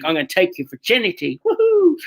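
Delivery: imitating a rowdy friend's whoop, rising into a high yelp; hammed-up lecherous whoop at the end; mock cheer. Friend teasing a naive virgin, mock whooping. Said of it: "He's like".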